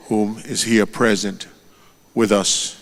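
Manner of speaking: ordinary talk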